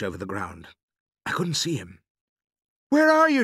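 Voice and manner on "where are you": very high, loud call. A narrator's reading. Boy searching for his father, calling out. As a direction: shout to someone you can't see.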